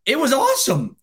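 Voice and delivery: happy podcast host gushing to his co-host about football; gushing excitement. Real, not acted. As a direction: pitch jumps high, bubbling over. Gushing about a relaxed weekend.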